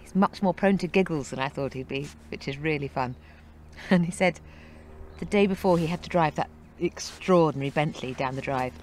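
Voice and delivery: ordinary delivery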